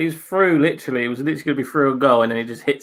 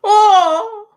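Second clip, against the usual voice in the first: high, loud "oh"; sudden high whoop at the action; excited cry; 7 dB louder than usual. Real, not acted. Streamer watching a great save, excited cry.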